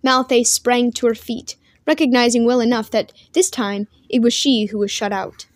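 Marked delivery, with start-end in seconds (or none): none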